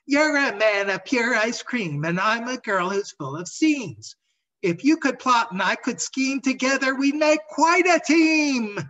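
high, loud sing-song rhyme, building to a shout; a giddy rag doll chanting her rhyme; high bouncy chant that bursts into a shout; gleeful patchwork girl. Acted. Scraps recites rhyme, delighted.

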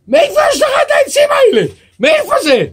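high, loud, fast furious demand; angry shout. Acted. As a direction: yell it at him, outraged. The rich man runs up, demanding. (צעקה כועסת, גבוהה ומהירה)

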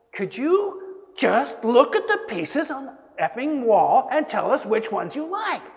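high, loud, exasperated demand; impatient retold yell. Teacher yells at the stranger to pick the artworks, exasperated. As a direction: lose patience and shout the question.

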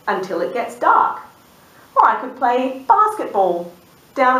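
bouncy rhyming read; reading to children. A narrator's reading. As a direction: read the list like a playful rhyme. Reading sheep's rhyming wishes.

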